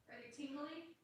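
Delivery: heard from another room, faint and distant; mother gently asking her son from afar; off mic, far away. Real, not acted. Mom checking on her crying son, far from the mic. Asks son about his legs.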